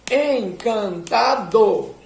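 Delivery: "Encantador" split very slowly into EN-CAN-TA-DOR; "Encantador" drawn out syllable by syllable. Shown as a demonstration. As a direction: beat out "Encantador" in four slow syllables. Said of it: "enquanto você tá falando as sílabas".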